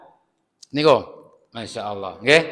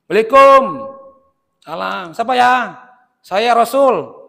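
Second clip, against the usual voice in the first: raised, high doorway call; calling through door; 7 semitones higher, 4 dB louder than usual. Acted. Sick man and visiting Prophet at the door, calling out. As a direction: call out in short bursts to whoever is outside.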